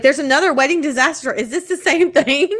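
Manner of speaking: excited surprise. Retold or imitated. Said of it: "I was like".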